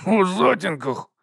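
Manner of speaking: high, drawn-out wheezing words; choked wheeze. A narrator's reading. Nobby answers after smoke went down the wrong way, choked wheeze. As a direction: wheeze it out through a smoke-filled throat. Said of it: "просипів він".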